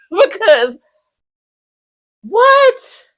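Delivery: very high, loud laughing what; incredulous shriek. Real, not acted. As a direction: squeal in disbelief.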